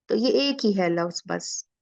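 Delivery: ordinary talk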